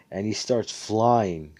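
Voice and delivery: ordinary talk, nothing marked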